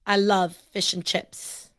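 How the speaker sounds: put-on very slow, careful British accent; imitated British accent. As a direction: mimic British English word by word. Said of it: "That's actually pretty good".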